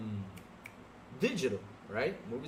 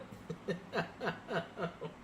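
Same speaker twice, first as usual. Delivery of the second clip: steady run of chuckles; gloating laugh; 2 semitones higher, 7 dB quieter than usual. Real, not acted. Streamer laughing as a viewer gets banned, gloating laugh. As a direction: laugh at their misfortune.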